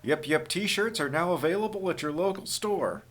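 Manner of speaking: chipper 1950s ad. Acted. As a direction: sell it like an old radio ad. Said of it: "Kevin says".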